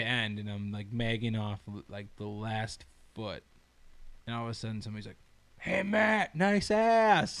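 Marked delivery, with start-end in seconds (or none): 5.6-7.4 higher-pitched heckle called out; imitated yell. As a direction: call it out across a job site. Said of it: "Just yells it out".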